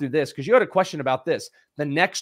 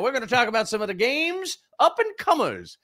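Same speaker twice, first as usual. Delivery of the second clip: put-on old prospector voice; 10 semitones higher than usual. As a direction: announce it like a gold-rush prospector. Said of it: "old-timey forty-niner voice".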